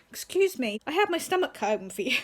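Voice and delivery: loud imitation of an indignant mother; mock-annoyed mum. Mum scolding her disobedient children, mock annoyance. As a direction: imitate mum telling off her kids, loudly. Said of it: "Whenever she's annoyed at me and my brother".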